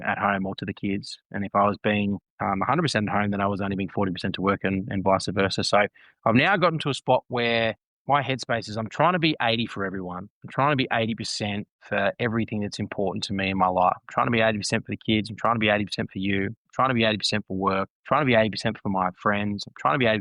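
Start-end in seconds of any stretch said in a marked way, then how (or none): none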